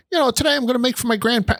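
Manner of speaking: high, quick imitation of an old Italian grandmother; playful nonna impression; sweet Italian nonna. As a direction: chirp it like a beaming old nonna. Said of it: "and she's like".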